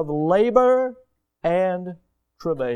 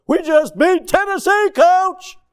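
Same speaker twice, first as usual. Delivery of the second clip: exhausted cheering players; 12 semitones higher, 6 dB louder than usual. Retold or imitated. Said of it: "They're like".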